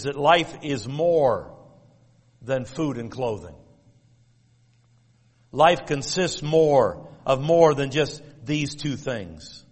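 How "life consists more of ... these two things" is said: slow emphatic point. Acted. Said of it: "preacher emphasizing main point".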